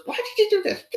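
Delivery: high-pitched nagging voice in the head; hectoring inner voice. Acted. Demonstrating the hectoring internal voice to soften, high hectoring tone. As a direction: play a hectoring inner critic, pitched right up. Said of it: "this hectoring internal voice that goes".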